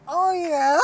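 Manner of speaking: toned-down take on his squeaky voice; squeaky imitation. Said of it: "I'm not even saying how he said it".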